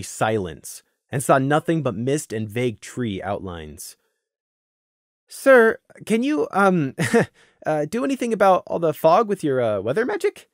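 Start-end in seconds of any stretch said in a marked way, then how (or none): 5.3-10.5 hesitant, higher nervous asking. A narrator's reading. Scenic nervously asking the sergeant about fog, a nervous laugh. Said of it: "Scenic asked nervously".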